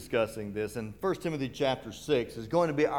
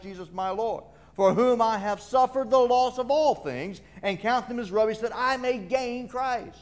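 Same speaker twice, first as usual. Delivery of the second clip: higher, louder preacher reading scripture; forceful scripture preaching; 7 semitones higher, 3 dB louder than usual. Acted. Preacher declaiming Paul's words to the congregation, forceful and high. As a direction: proclaim the verse with rising force.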